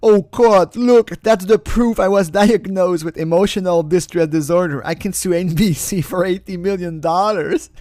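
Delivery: hammed-up triumphant whine; mock gleeful plaintiff. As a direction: crow it like you've won the lottery. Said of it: "Lauren is gonna say".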